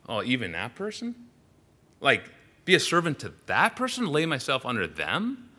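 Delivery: balking, pitch climbing on the questions; incredulous reluctance. Acted. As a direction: voice someone balking at a distasteful idea. Voicing a reluctant, doubting person.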